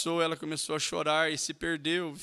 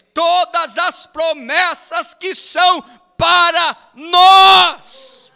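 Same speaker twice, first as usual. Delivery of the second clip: higher, louder shout on the promise; shouted declaration; 14 semitones higher, 19 dB louder, much slower than usual. Real, not acted. Preacher shouting the promises to the congregation, full-voiced shout. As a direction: cry it out to the whole church.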